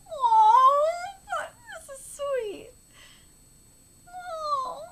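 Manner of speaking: high, drawn-out tearful aww; coo, sweetly overcome; real high-pitched melting reaction; touched cooing. Real, not acted. Touched reaction to the birthday scene.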